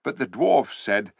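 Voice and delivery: ordinary delivery, nothing marked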